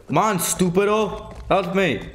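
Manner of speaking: hammed-up cries of help to the game; mock calls for help. Stuck in game, calling for help.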